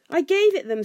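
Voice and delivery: high, loud squeak; high-pitched panicky confession; frightened squeak. A narrator's reading. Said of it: "squeaked Bilbo".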